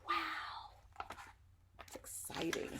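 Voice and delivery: hushed, excited whisper aside; whispered wow. Real, not acted. Teacher reading aloud whispers her excitement to the kids. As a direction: breathe it out like a secret.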